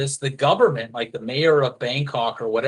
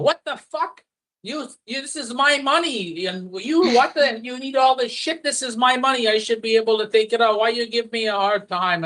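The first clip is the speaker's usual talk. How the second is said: much higher, pitched-up mock outrage; imitating a yelling foreigner; 9 semitones higher than usual. Retelling a foreign customer yelling at the bank teller, high mock outrage. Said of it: "he started yelling at the teller".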